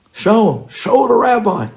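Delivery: high, loud, pushing repeats; insistent urging. Retold or imitated. Buddy insisting loudly.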